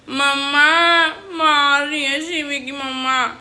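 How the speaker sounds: put-on high, slow crying child's wail; mock crying child complaining about being hit; whiny child. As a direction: wail it like a kid telling on mum. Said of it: "ऐसे बोलते हैं बच्चे".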